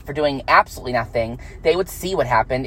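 usual speaking voice

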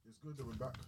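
ordinary talk, nothing marked

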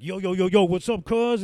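imitating how coworkers think he talks; mocking the gangster image they pin on him. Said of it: "they pretty much have told me that I talk like this".

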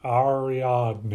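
Ariadne with broad flat A vowels, Midwestern; nasal Midwestern accent on Ariadne, ah-ree-AHD-nee. Shown as a demonstration. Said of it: "but nasally".

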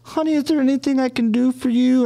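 put-on old lady asks the angry man if she can help, in a high sweet voice. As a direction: play a kindly little old lady offering help. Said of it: "she's like".

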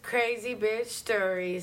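slow, drawn-out show title. Acted. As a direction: announce the segment name slowly.

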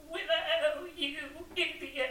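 high furious yell at Willow; angry goat-woman shriek. Retold or imitated. Sorceress turned goat yelling at Willow, high and furious. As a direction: screech at the bungling wizard. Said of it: "there's this hilarious scene where she's like".

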